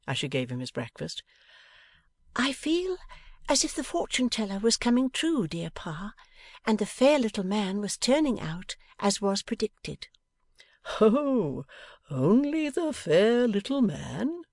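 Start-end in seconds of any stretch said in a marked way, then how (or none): 2.4-10.1 put-on higher lively young woman. A narrator's reading. Bella voiced. 11.0-14.4 teasing father. A narrator's reading. Pa voiced.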